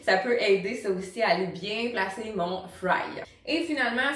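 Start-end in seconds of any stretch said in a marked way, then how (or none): none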